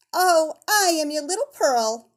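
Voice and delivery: put-on high, loud playful child voice; a giggly little girl teasing her mother; laughing little girl. A narrator's reading. Little Pearl teases her mother, high and playful. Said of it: "answered the child, laughing".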